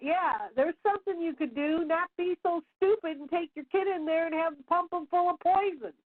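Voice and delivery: high, indignant inner comeback; scornful retort. Acted. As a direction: snap back at the foolish mother in your head. Her angry inner retort to mother.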